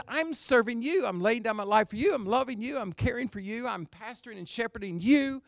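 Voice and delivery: high, rolling sermon cadence; fervent preaching. Acted. Preacher voicing Paul's care for his church, high and fervent. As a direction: keep the pitch up and pile phrase on phrase.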